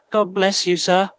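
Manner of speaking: high, choked sob of thanks; sobbing. Acted. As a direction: blurt the blessing through tears. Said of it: "sobbed Private Richard Doubledick".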